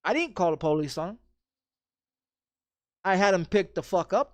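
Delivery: normal talking voice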